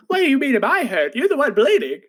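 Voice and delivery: put-on playful high girl voice, exasperated; indignant girl. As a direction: voice a girl who can't believe him. Girl indignant at the hurt boy.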